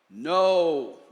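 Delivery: loud boom of a no. A narrator's reading. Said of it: "boomed the stink bug".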